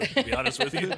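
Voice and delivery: giggling confession; laughing. Real, not acted. Laughing admission.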